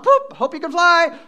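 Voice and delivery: high, loud cartoon bird voice pushing the chick out, laughing; mock parent bird. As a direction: squawk it like a careless mother bird.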